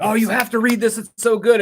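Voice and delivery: excited re-enacted urging; eager recommendation. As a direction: gush while pushing a book on a friend. Retells eagerly pushing a book.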